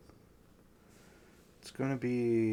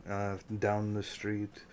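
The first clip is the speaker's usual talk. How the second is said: ordinary talk, nothing marked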